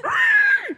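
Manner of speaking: piercing, very high goblin scream, loud. Retold or imitated. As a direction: shriek like a goblin in pain. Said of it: "I was like".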